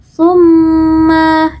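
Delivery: "tsumma" chanted with an airy th and held m; free-flowing breath. Shown as a demonstration. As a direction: let air escape on the first sound of "tsumma". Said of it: "udara yang ada di mulut kita". ("tsumma" dengan udara keluar bebas dan dengung panjang)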